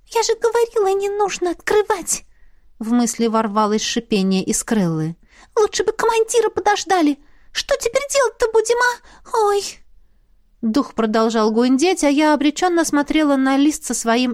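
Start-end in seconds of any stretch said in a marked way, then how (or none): 0.1-2.2 high, squeaky little spirit, businesslike. Acted. Spirit talking business with the heroine, high squeaky voice. 5.6-9.6 high, squeaky little spirit, businesslike. Acted. Spirit talking business with the heroine, high squeaky voice.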